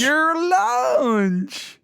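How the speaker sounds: high, slow Southern twang; Southern accent. Pretend. Co-host repeating the word, in a Southern accent. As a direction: drag "lunch" out in a Southern accent. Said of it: "with, with this accent".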